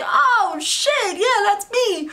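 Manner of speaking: high-pitched acted-out thrilled squeal of recognition; starstruck excitement. Fan answering her favorite DJ, starstruck and excited. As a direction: re-enact being thrilled to be recognized.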